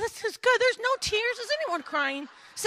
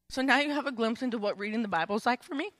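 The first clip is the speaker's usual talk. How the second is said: ordinary talk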